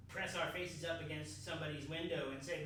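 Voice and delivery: ordinary talk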